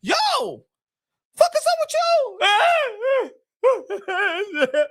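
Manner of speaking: high-pitched incredulous outburst; alarmed yell. Acted. Buddy confronting his suddenly crying friend, alarmed high yell. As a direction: demand an answer, voice shooting way up.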